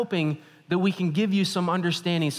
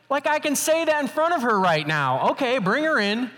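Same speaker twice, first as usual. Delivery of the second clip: high-pitched mock disbelief; retold indignant aside, pitched high; exasperated sarcasm; 9 semitones higher, 4 dB louder than usual. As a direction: give in with exaggerated, put-upon sarcasm. Retold sarcastic inner reply to doctor.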